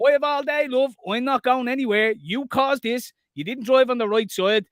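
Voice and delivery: taunting. Retold or imitated. Retelling taunting her.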